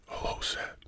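hushed, startled whisper at a noise; spooked whisper, listening hard; scared whisper. Real, not acted. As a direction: whisper it like something moved in the dark. Hears noise, thinks ghost.

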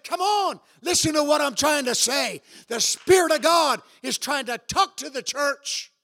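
high shouted preaching. Real, not acted. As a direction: shout it to the church.

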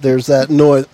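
ordinary talk

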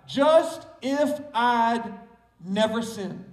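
"Just if I'd" slowly spaced out, word by word; word broken down. Shown as a demonstration. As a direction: draw out "Just if I'd", pause, then "never sinned". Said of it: "Just break the word down".

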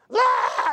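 high, loud imitated newborn wail; mock baby scream. Preacher imitates a newborn's scream. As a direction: shriek like a newborn just born.

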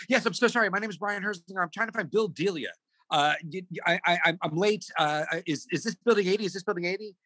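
high, stammering apology; retold fluster. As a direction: act out a late, flustered newcomer. Retold flustered late arrival.

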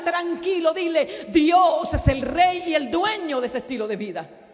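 loud, high preaching crescendo; full-force preaching. Acted. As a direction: rouse the congregation. (predicación ferviente a todo volumen)

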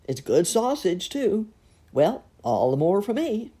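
a cheerful glutton coaxing, with a hint of a put-on voice; jolly fat man.

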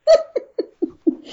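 loud, high laugh; high burst of laughter. Real, not acted.

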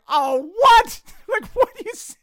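high, loud, laughing disbelief; shocked. Retold or imitated. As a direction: gasp it out in mortified shock. Shock at eye cancer reveal.

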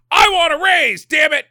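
high, loud hammed-up shout; mock angry demand. Elfman will go tell Santa, loud mock outrage.

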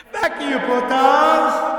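gangster yell. Acted. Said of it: "He did the gangster, like, fucking yell".

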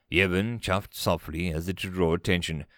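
ordinary delivery

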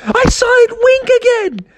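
put-on very high, excited boy voice; excited Froggy. Froggy squealing that it winked again.

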